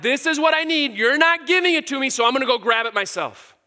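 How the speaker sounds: mock a spoiled demand for what we want. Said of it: "do we claim to know better than God".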